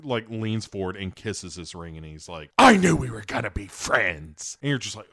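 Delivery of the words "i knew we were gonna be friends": louder hammed-up Joker; a creepy villain claiming a friend; mock Joker, pleased with himself. Joker voiced to Ike.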